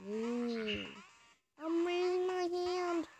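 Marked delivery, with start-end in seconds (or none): 1.6-3.1 higher singsong call. Acted. Repeating a call in-game.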